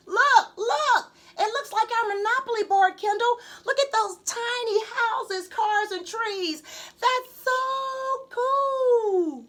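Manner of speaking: high, loud thrilled yelling, slowing to a drawn-out cheer; a boy amazed by the view below; excited shout. Acted. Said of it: "McKinley shouted".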